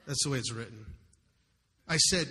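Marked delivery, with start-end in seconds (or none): none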